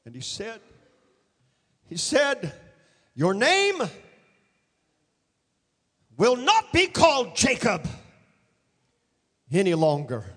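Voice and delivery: ordinary talk, nothing marked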